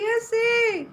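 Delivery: a happy cry of recognition; excited squeal. Retold or imitated. Greeting a friend at a session with an excited squeal. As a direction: squeal the name high and delighted. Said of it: "I'm just like".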